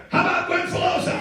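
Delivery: high, loud call for applause; rousing shout-out. Real, not acted. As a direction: whip up the crowd for a performer. Host calls for applause.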